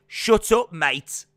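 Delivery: loud, high Brummie impression; hammed-up Birmingham tough talk; Pete Dunne. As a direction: punch it out loud, dropping on the last word. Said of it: "depth to my voice to get to Pete Dunne".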